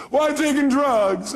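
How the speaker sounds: slow, high imitation of a client's excuse; mock-defensive protest; protesting caller. Voices caller's excuse to the coach.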